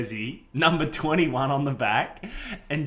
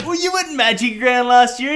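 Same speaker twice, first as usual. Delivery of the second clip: excited; 10 semitones higher, 6 dB louder than usual. Retold or imitated. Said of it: "And, and I was like".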